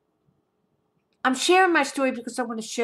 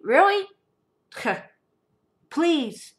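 high, sarcastic really; scornful really; 5 semitones higher, slower than usual. Real, not acted. Scoffing at the ex's love message, sarcastic. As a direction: roll your eyes at it.

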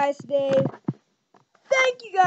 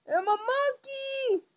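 silly voice; 7 semitones higher, 7 dB quieter, slower than usual. Pretend. Silly high slow voice.

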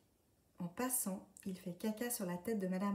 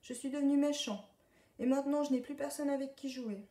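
tearful confession; 7 semitones higher, 6 dB louder than usual. A narrator's reading. Duckling confesses, near tears.

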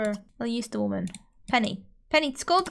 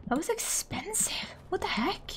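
whispered aside; 4 semitones higher, 7 dB quieter than usual. Real, not acted. Shocked aside at the price.

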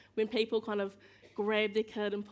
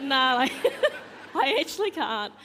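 laughing it off, louder; a loud laugh bursts through the words; 5 semitones higher, 9 dB louder than usual. Retold or imitated. Telling a plane passenger she can't stand, laughing.

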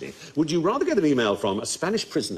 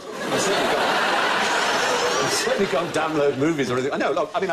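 ordinary talk, nothing marked

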